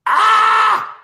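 short high yell of pure frustration; exasperated shriek; frustrated scream. Real, not acted. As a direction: let out a sudden high scream at the broken software. Software fails again, frustrated.

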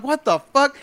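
baffled disbelief. Retold or imitated. Retelling baffled reaction to intruder.

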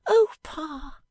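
put-on a bright young wife teasing her Pa; young Bella. Bella speaks to her father.

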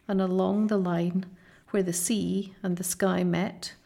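ordinary delivery, nothing marked

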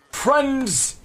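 high, ringing herald's call; grand rhythmic bard. Acted. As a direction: proclaim it like a town crier. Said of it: "I got him my bard voice back".

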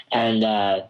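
ordinary talk, nothing marked

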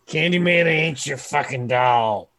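loud, furious in-character yell; angry shout. Pretend. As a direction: shout it at him in anger. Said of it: "I'm gonna go".

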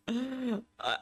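laughing through tears, pitch jumping high; overcome, half laughing half crying; choked-up laugh. Real, not acted. Fan overwhelmed by the comeback, laughing through tears.

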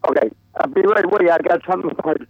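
faint, muffled voice over a bad phone; clipped phone line. Real, not acted. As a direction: speak down a poor phone line. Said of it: "I can barely hear you".

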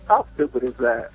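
ordinary talk, nothing marked

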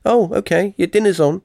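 mum calling up high from downstairs; yelling across the room. Retold or imitated. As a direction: shout up the stairs like a mum calling. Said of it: "me mum comes and goes".

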